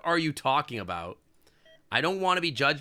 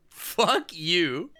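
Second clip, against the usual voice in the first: angry retort; 4 semitones higher, 3 dB louder than usual. Real, not acted.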